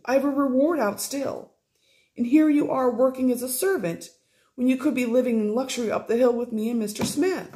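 relieved stepmother rattling on to Sophie, gushing and high; gushing Fanny. Acted. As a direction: pour it all out in one breathless rush.

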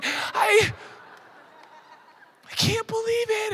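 high, fast, excited squeal with giggle; giddy squealing girl. Retold or imitated. Preacher mimics a teen girl gushing over a crush, high giggly squeal. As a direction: gush like a smitten teen girl. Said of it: "You know, like".

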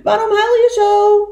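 high, loud repeat from afar; echoed call. Acted. Echo answering the beaver across the lake, loud high call. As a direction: throw the question back like an echo. Echo calls the question back.

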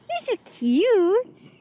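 slow, high, drawn-out cooing; cooing pet talk. Real, not acted. Cooing at the dog.

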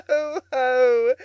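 loud, gloating ho-ho of Toad; ecstatic self-admiration. A narrator's reading. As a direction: crow with delight at your own cleverness. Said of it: "he cried in ecstasies of self-admiration".

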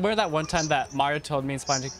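ordinary talk

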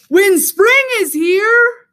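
loud, high, drawn-out cheer; high jubilant shout, words stretched; noisy outburst. A narrator's reading. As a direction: burst out slowly and loudly. Animals burst out noisily.

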